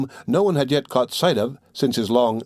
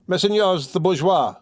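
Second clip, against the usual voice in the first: high, slow cry over the crowd; 7 semitones higher, slower than usual. A narrator's reading. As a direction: call out to a hooting crowd. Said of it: "he cried at the top of his lungs".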